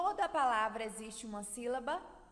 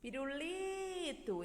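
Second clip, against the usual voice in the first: Pirulito with a long, high LI; piru-LIII-to, called out slowly; held on LI; 5 semitones higher, slower than usual. Shown as a demonstration. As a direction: stop and linger on the LI in Pirulito. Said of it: "a gente parou no li".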